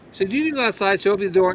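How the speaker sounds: normal talking voice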